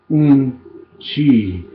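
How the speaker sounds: Un, chi, split in two syllables with Un punched; unchi. Shown as a demonstration. Teacher drilling grandmother in Lakota for learners. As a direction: break Un chi apart, hit the Un. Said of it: "Remember that".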